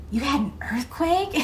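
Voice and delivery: high, eager, thrilled question; excited Michael. Retold or imitated. Michael hearing of a quake, excited and high. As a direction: imitate Michael's delight at the news. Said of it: "He got so excited".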